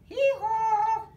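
loud high hee-haw bray; donkey bray. Acted. Reader braying as the donkey, loud and high.

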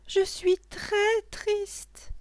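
put-on very high, slow child's voice; high, slow child's lament; sad little girl. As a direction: draw it out as a sorry little girl. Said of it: "lui répondit l'enfant".

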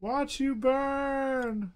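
taunting villain voice. Acted. Gamer taunting enemy in game.

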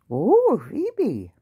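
normal talking voice

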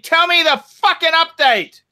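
high, impatient order; angry demand. Real, not acted. Host demanding Clivey's update, angry and impatient. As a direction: insist on an answer now.

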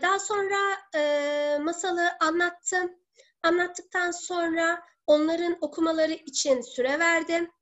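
ordinary talk

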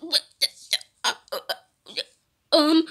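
usual speaking voice

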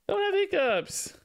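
high pleading coo. Real, not acted. Talking to the baby.

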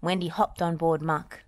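ordinary delivery, nothing marked

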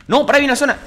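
high, loud cry of alarm; alarmed shout. Real, not acted. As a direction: yell at sudden danger. Sudden danger in game. (grito de alarma)

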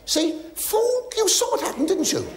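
a high, shrieking jab at the fool; shrill mock outrage. Real, not acted. Comic grumbling about the useless stage manager, squealed high. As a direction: jump way up high and squeal the insult.

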